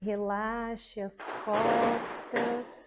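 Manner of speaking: slow, soft, soothing relaxation voice; guided meditation. Shown as a demonstration. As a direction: lull the class into calm, very slowly. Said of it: "E aí você vai começar assim, ó".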